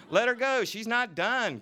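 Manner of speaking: man in the audience yelling for an encore; crowd heckle. Acted. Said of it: "cried a man in front".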